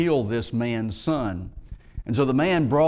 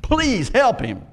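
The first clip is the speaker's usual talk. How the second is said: high, desperate plea, a little louder and slower; pleading father; 9 semitones higher, 4 dB louder, slower than usual. Acted. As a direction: beg them to help your son. Father begs disciples for son.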